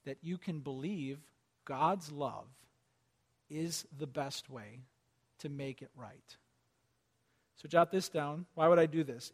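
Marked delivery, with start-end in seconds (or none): 0.1-6.4 much softer, lower and slower; a hushed, sincere plea; tender appeal. Real, not acted. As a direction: draw them in gently.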